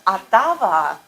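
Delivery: "Ottawa" said the Russian way, with a v: atava; Russian-accented "Ottawa", open a sounds. Shown as a demonstration. Teacher repeats a student's answer in a Russian accent. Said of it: "very, very Russian accent".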